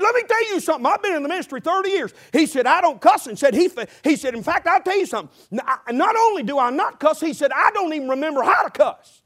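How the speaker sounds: hammed-up high prim preacher voice; pastor's voice. Voicing the pastor in story.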